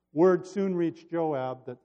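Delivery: lively, expressive Bible narration; storyteller at the lectern; scripture story reading. Acted. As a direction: read the story aloud with animated quotes. Reads the Bible story aloud.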